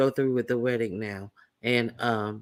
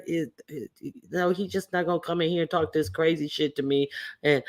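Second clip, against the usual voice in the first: ordinary talk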